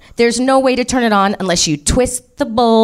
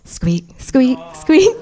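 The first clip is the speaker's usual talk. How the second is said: imitated squeaking; 4 semitones higher, much slower than usual.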